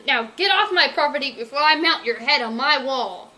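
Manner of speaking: loud threatening yell. Acted. Villain driving Berkeley off, loud threatening yell. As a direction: shout him off the property. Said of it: "Night Pumper yelled".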